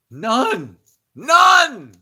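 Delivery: rising shout. Acted. Preacher builds to a shout.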